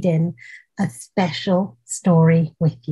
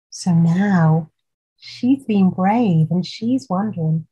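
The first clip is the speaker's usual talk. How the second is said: usual speaking voice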